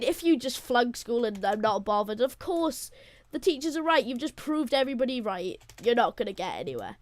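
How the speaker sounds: ordinary talk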